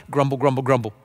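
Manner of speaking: low-pitched, monotone mock grumbling; grumbling murmur. Church member griping over money requests, in a mock grumbling murmur. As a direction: imitate a complainer's low-toned murmur. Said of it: "this low-toned murmur".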